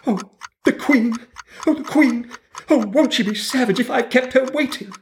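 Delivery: high, hurried rabbit fretting. A narrator's reading. Rabbit frets to himself about the Queen, high and hurried. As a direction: mutter anxiously like a late rabbit. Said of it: "muttering to himself as he came".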